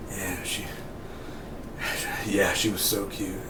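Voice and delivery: soft, halting mock-tearful recollection; imitated subdued grief. Punisher remembering his daughter, imitated quiet grief. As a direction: choke it back with long pauses, acting.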